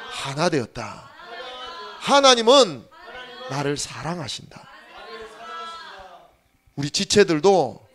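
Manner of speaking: loud preaching, a leader's call for the church to repeat; call and response. Real, not acted. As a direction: shout each phrase out to be echoed. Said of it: "따라합시다".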